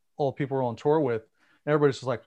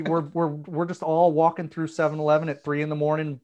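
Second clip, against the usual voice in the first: ordinary delivery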